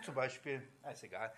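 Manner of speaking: ordinary talk, nothing marked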